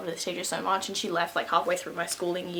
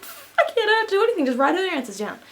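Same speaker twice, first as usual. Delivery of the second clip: reckless decision; 11 semitones higher, 3 dB louder than usual. Retold or imitated. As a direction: toss it off high, then come down. Acts out own reckless decision.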